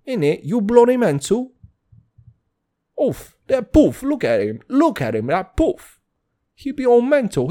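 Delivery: high, mock-outraged British accent; hammed-up British lad, pitched high and incredulous.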